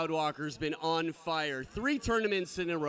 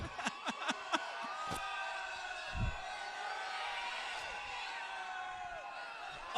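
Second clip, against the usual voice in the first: big laugh; 13 dB quieter than usual. Real, not acted. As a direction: roar with laughter. Impersonation game, big laugh.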